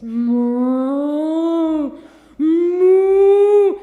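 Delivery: long, very loud, slow moos; loud mooing. Acted. Storyteller mooing as the cow resists the leash. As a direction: stretch out a huge cow moo.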